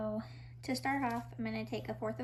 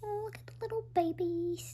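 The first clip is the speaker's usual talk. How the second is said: high breathy whisper to the yeast; cooing baby talk; 7 semitones higher than usual. Acted. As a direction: coo at them like tiny babies.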